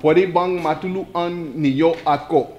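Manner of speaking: Pwede bang matulungan ninyo ako slowly, syllable by syllable; slow it down for the class. Shown as a demonstration. Teacher repeats the Tagalog phrase for students, slowly. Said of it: "Okay, I'll say it slow".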